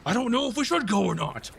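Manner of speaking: high, quick put-on voice; doubting crewmate. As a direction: waver and object as a squabbling underling. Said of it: "And then the other goes".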